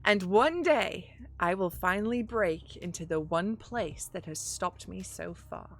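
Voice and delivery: usual speaking voice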